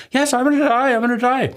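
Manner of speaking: put-on shrill it back like a terrified child; panicked body voice. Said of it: "my body tells me".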